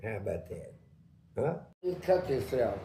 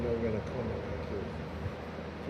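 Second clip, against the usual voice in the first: low, soft and very slow threat; menacing inmate; 6 semitones lower, 9 dB quieter, much slower than usual. Retold or imitated. The worst inmate threatening the newcomer, low slow quiet menace.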